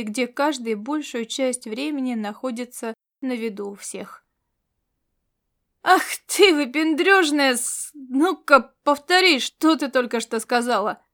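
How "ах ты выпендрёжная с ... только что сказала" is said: furious shout. A narrator's reading. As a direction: yell it at her in outrage. Soldier flares up in quarrel. (яростный крик)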